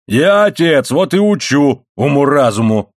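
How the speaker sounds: loud, high blustering assertion; raging father. A narrator's reading. Father justifying himself to his wife, raging. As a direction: thunder out paternal authority. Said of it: "бушевал Моррелл".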